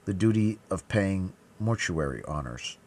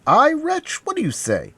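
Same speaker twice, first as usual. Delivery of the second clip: loud outburst, starting very high; shocked cry; 8 dB louder than usual. A narrator's reading. D'Artagnan challenging the dying man, indignant high cry. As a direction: burst out at the accuser. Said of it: "cried D'Artagnan".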